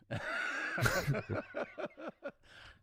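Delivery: big, high burst of laughter; long, high laughing fit. Real, not acted. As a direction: explode laughing at the punchline. Punchline of mistaken show name.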